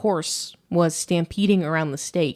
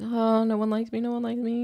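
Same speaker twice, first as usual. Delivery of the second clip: higher, softer whiny mock sob; mock crying; 6 semitones higher, 4 dB quieter than usual. Imitating the lonely crying man, whiny mock crying. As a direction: whimper it like a sorry crying man. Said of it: "crying man".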